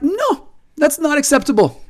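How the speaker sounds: a mock voice for the Argentines, not an accent; odd put-on voice. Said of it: "was not an attempt at an Argentine accent".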